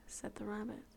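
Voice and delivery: plain reading voice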